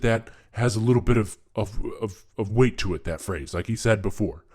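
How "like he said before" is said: normal talking voice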